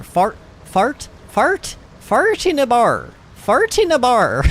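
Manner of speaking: repeating fart in a bar until the Irish accent lands. Shown as a demonstration. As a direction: keep drilling the Irish vowels in fart in a bar. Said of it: "it didn't even sound right".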